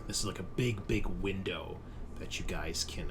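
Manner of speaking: ordinary talk, nothing marked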